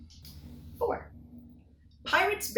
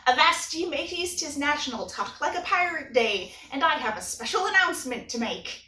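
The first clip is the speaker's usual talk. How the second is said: hammy pirate voice, growling arr; cheesy pirate accent; 3 semitones higher than usual. Acted. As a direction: ham up a pirate accent for fun. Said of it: "All kidding and cheesy accents aside".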